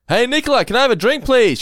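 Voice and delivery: oblivious too-loud call to someone nearby; yelling out. Retold or imitated. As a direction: re-enact yelling over headphones. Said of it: "What are you yelling for?".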